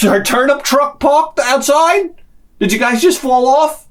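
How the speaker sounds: high-pitched acted disbelief; mock astonishment, voice climbing high; incredulous. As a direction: ask it as if utterly baffled by them. Acted incredulous reaction to naive siblings.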